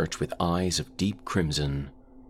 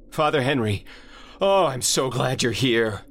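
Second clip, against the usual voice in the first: much higher, louder burst of relief; relieved cry; 9 semitones higher, 3 dB louder than usual. Acted. Narrator greeting the priest with loud high relief. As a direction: call out like rescue has arrived.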